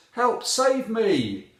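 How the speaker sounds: high, drawn-out loud plea; cry for help. A narrator's reading. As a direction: call slowly and loudly for rescue. Heroine calls out for rescue.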